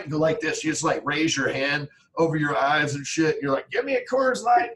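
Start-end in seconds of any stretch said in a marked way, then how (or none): 3.7-4.7 high-pitched comic demand for a beer; mock drinker demand. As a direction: play someone whining for a drink. Mimicking someone avoiding the light.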